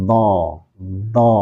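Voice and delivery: "do" with the voice stretched out; lengthened dhad. Shown as a demonstration. Teacher demonstrating istitolah to students, prolonged "do". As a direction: draw out the sound of each "do". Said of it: "Jadi memanjangkan suara".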